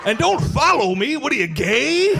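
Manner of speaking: put-on high, loud, laughing old-guy scold; gruff old-fashioned dad. Old YouTube dad warning off viewers, high mock scold. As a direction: scold like a cranky old-timer. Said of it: "He's just like".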